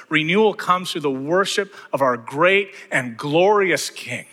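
impassioned pulpit climax; preaching at full force. Acted. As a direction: build the congregation up to a fervent peak.

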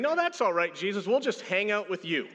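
high-pitched, chummy brush-off; breezy refusal. Acted. Friends turning down Jesus's suggestion, breezy refusal.

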